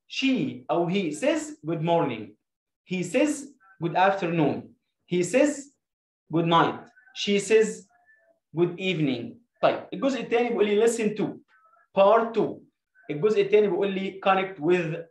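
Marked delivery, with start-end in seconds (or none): 0.1-9.4 slow drill. Shown as a demonstration. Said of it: "على المضارع البسيط".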